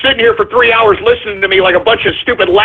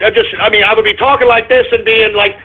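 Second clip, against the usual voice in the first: ordinary talk, nothing marked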